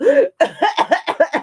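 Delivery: loud, high acted coughing fit. Muskrat is lifted up by the fish, coughing hard. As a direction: splutter like someone pulled from the water. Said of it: "he was coughing".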